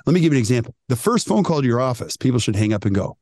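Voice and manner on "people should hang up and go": usual speaking voice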